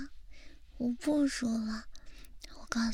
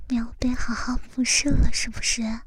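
whispering; 4 dB louder than usual. Acted. Loud close whisper ASMR.

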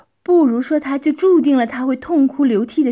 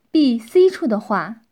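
usual speaking voice

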